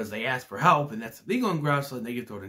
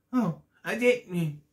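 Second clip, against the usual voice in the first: mock Oscar voice; 6 semitones higher, much slower than usual. Oscar denies it.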